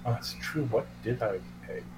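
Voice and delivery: ordinary talk, nothing marked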